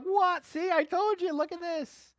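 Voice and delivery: high, loud astonished cry of what; startled and amazed at the tongues; astonished reaction. Retold or imitated. Listener shocked to see tongues, a loud astonished cry. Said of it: "You're like".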